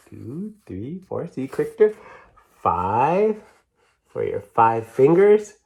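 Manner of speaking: put-on sing-song counting for a child; picture-book counting voice; reading to children. A narrator's reading. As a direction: count them out brightly, rising on each number. Reads counting book aloud.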